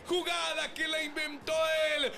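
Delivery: high, loud goal scream; breakneck goal commentary. Real, not acted. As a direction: erupt into the goal call. Goal scored, commentator erupts.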